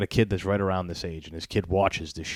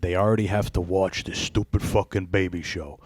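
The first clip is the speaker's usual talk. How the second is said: ordinary talk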